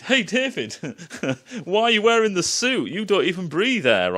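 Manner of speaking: high, amused mimicry of a silly question; mock dumb questioner. Mimicking Holloway's dumb line to David.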